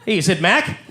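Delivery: normal talking voice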